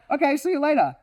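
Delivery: high, airy mock goodbye; breezy departing guest. Guest leaving the excited dog after a pat, high breezy goodbye. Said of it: "And then they're like".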